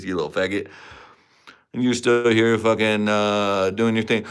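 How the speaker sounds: usual speaking voice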